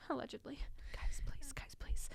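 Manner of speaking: hushed whisper. Real, not acted. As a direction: whisper it like a secret. Whispered aside to audience.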